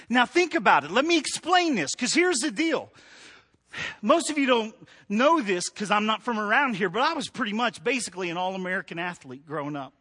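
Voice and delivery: usual speaking voice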